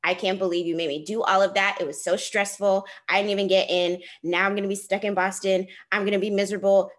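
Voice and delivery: ordinary delivery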